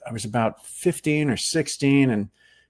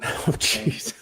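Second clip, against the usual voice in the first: laughing embarrassed; 8 semitones higher, 5 dB quieter than usual. Real, not acted. Embarrassed by old photo.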